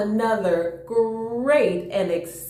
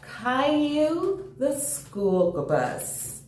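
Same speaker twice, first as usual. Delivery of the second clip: slow, drawn-out title read; storybook title called out slowly; title announcement; 7 semitones higher, much slower than usual. Acted.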